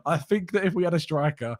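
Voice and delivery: ordinary delivery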